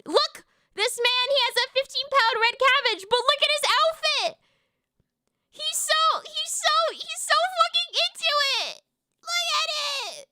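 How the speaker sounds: high-pitched squealing enthusiasm; gushing delight. Real, not acted. Gushing over man's cabbage outfit.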